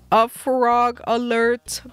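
drawn-out frog alarm. Real, not acted. Streamer announcing a frog to viewers, slow loud alert. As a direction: sound the alarm, slowly and loudly.

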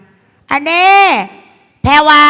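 high, loud call to a pupil; hammed-up schoolteacher shout; mock teacher summons. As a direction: call out a student in trouble. Acting teacher summoning errant pupil.

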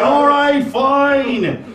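high yelled surrender; exasperated shout. Retold or imitated. Giving in shouting at cat.

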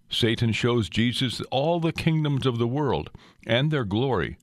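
ordinary talk

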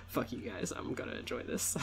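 soft whisper, half to himself; whispered aside. Real, not acted. After telling viewers off, a sly whisper to himself.

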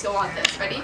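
soft breathy whisper, hushed. Real, not acted. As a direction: drop to a hush so they listen. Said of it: "listen to this noise".

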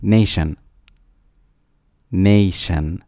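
usual speaking voice

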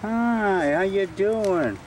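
high, cooing voice for the dog. Real, not acted. Man greeting his buddy the dog, high cooing baby talk.

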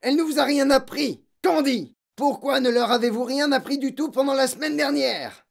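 loud, high-pitched berating; angry accusation. A narrator's reading. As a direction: berate the teacher furiously. Headmistress scolds the teacher.

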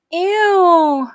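high, loud squealing "Ew"; a girl ghoul howling in disgust. Acted. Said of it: "howled Wilma".

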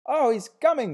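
very high, loud frenzied scream. A narrator's reading. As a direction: scream it out in ecstasy. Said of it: "screamed Clara Dietering".

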